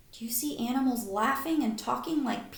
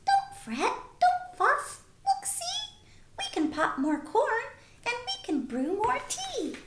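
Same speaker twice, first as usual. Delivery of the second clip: much higher, squeaky little mouse voice; 12 semitones higher than usual. Acted. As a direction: squeak it like a tiny cheerful mouse. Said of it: "Mouse squeaks".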